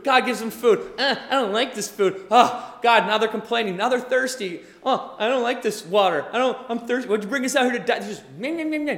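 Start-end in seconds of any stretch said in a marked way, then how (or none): 1.0-3.1 loud grumbling complaint; hammed-up complaining crowd; mock whining. Whining Israelites. 4.8-8.0 loud thirsty complaining; hammed-up grumbling crowd; mock whining. Whining Israelites. 8.0-9.0 high whiny meh meh; childish bleating whine; mock whining meh. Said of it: "just whining and complaining".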